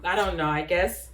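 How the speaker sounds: ordinary talk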